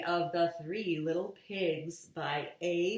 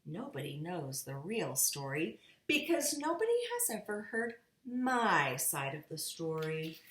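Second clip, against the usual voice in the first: ordinary delivery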